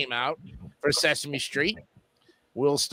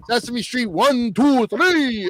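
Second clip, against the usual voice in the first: put-on Transylvanian accent; 5 semitones higher, 4 dB louder, slower than usual. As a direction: Count von Count voice. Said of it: "Good, good count voice".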